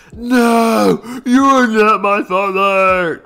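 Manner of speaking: high, loud, slow anguished no; Star Wars denial. Pretend. Son refusing to believe his father, loud parody wail. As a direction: wail it like the famous movie scene. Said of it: "On some Star Wars type stuff".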